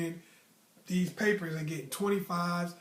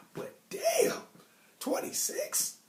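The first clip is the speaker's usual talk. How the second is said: high, breathy mock gasp; incredulous; slower than usual. Recalling a classmate's awful quiz score, high mock gasp. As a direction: squeak out the number in disbelief.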